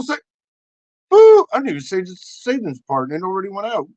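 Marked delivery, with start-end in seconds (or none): 1.1-1.4 imitated automatic beep; piercing mock alert boop; high loud boop.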